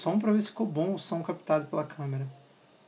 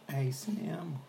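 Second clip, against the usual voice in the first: soft whisper for a mic test; 9 dB quieter than usual. Shown as a demonstration. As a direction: whisper it to check the sound. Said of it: "Será que ficou uma merda o som?".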